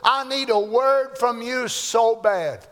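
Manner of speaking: a lightly voiced desperate plea; retold pleading prayer. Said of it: "I said".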